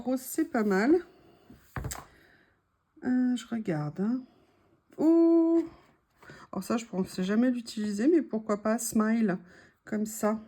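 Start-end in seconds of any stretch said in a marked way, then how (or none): 5.0-5.6 sharp high loud gasp of surprise; surprised oh. Real, not acted. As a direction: burst out in delighted surprise. Sudden delighted find.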